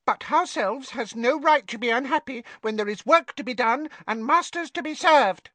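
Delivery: high, bowing house elf; a meek little elf; polite elf voice. A narrator's reading.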